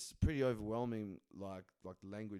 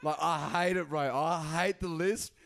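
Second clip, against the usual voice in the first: loud, slow complaint; 6 semitones higher, 8 dB louder than usual. Real, not acted.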